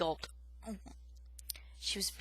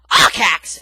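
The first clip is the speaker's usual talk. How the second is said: very loud surprised yelp; 3 semitones lower, 20 dB louder than usual. Acted. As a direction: yelp the name. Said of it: "Aerith yelped".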